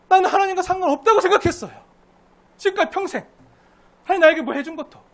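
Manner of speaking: loud, high, anguished complaint; impassioned outcry. Acted. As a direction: cry out a lifetime of bitterness. Preacher voicing bitter Zacchaeus.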